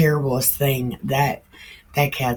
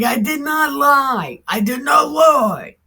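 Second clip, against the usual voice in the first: high, loud insistence, hammed up; indignant shout; 10 semitones higher, 8 dB louder than usual. Prophet shouting his denial at the angry woman, mock outrage. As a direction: yell your innocence twice over.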